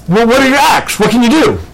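a hint of a brisk interviewer asking. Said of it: "the guy interviewing him says".

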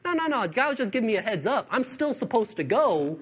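breezy and unbothered by danger; cheerful Paul voice. Retold or imitated.

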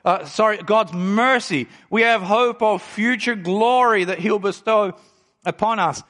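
usual speaking voice

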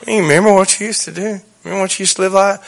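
hushed tempter's voice; the devil reminding. Acted. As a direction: quietly needle with reminders, voice held soft. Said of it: "because the devil had my old clothes on".

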